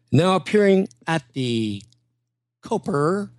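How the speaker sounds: pause, then lift "Coper" with a hard R; exaggerated pronunciation. Impressionist needling the host, "Coper" with a hard R. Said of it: "I don't say Coper. I say Copa".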